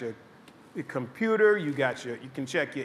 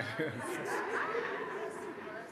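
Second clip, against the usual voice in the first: laugh; 4 semitones higher, 12 dB quieter than usual. Real, not acted. Laughing after the retold remark.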